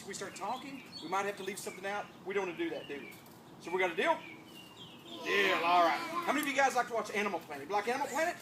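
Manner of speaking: ordinary talk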